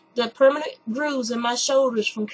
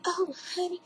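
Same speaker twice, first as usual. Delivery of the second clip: high, soft, syrupy mock sweetness; mock-sweet lady in a waiting room; imitating the lady; 8 semitones higher, 7 dB quieter than usual. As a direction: coo it like a condescending stranger. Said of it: "she goes".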